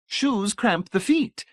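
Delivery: high, slow, eager pronouncement; bright-eyed enthusiasm. A narrator's reading. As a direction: proclaim it keenly, high and drawn out. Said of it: "bright-eyed with enthusiasm".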